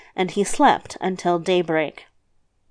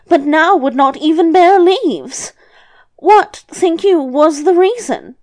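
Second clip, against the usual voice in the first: put-on high, louder, animated old woman; grandmother telling a dream; 7 semitones higher, 5 dB louder than usual. Grandmother telling the devil her second dream, high-voiced. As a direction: raise the pitch right up for the grandmother.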